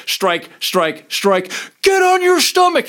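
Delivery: a retold cop roaring at a suspect on the ground; barked police command. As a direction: yell it like a cop mid-arrest. Cop beating, shouting commands.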